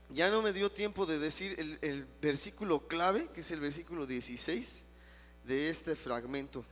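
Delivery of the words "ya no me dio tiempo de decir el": normal talking voice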